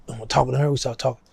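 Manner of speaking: ordinary talk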